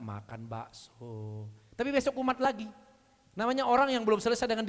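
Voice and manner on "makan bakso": very slow, low and soft; drawn-out punchline. Acted. Preacher's comic punchline about the pampered son, slow and low. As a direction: let the words drag out for the joke.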